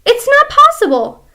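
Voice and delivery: wife frantic she cannot be shrinking, high-pitched; high old wife protest. Acted. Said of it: "Missus Twit".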